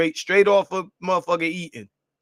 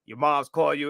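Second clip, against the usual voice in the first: ordinary talk, nothing marked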